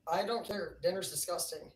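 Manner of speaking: normal talking voice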